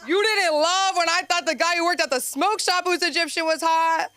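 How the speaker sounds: high, loud accusing call-out; a high loud accusation aimed at mom. Acted. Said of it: "scolding mom directly".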